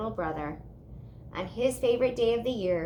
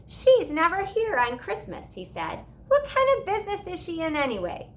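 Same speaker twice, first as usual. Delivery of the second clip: curious little brother; 9 semitones higher, 5 dB louder than usual. A narrator's reading.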